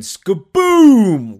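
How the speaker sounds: loud, drawn-out crash word; big stretched-out comic explosion; booming crash effect. Retold or imitated. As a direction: boom it out like the impact. Imitates the crash of the boat.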